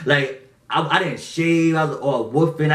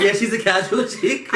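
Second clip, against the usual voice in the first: sarcastic; 5 semitones higher than usual. Retold or imitated. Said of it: "I was about to write line with you".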